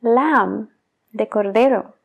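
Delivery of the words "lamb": "Lamb" said as lam, no b; silent b in lamb. Shown as a demonstration. Teacher models "Lamb" with the b dropped. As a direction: stop "Lamb" on the m. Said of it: "Ignora la B completamente".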